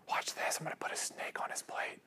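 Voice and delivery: whispered, scheming parent aside; mock sneaky whisper. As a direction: hiss it like a sly prank plan.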